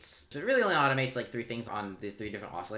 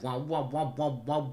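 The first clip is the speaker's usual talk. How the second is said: imitated wobble; 5 semitones higher, 4 dB louder than usual. Imitating the wobbly bass sound.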